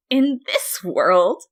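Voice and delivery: Flowey shrieking. A narrator's reading. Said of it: "Flowey shrieked".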